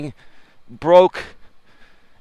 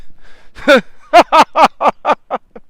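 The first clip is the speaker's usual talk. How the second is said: big high cackle; high loud laugh; 8 semitones higher, 4 dB louder than usual. Real, not acted. Laughing at his own story.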